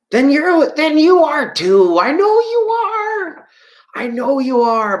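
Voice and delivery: high, sing-out playground comeback; childish taunt. Retold or imitated. Kid answering name-calling in the playground, high drawn-out taunt. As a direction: stretch it out high like a teasing kid. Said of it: "Remember when you're a kid".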